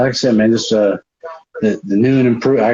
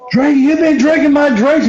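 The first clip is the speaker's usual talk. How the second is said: high, whiny indignant question; mock accusation; 12 semitones higher than usual. Billy confronts sloshed Drake about his drink, indignant. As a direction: confront the suspect in a hammed-up whine.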